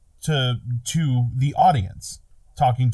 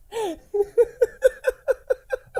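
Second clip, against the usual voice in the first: high-pitched laughing fit; long high giggling burst; laughter. Real, not acted. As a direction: laugh helplessly at the joke. Laughing at the joke.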